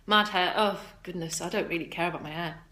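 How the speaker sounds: normal talking voice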